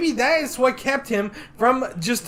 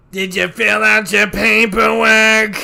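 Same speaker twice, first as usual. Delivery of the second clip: Roz impression; 3 semitones higher, 8 dB louder than usual. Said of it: "the person from Monsters, Inc".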